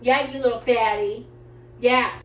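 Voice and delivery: loud teasing to the dog; teasing scold. Real, not acted.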